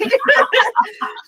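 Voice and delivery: high-pitched burst of laughter. Real, not acted. Laughing at the dog video.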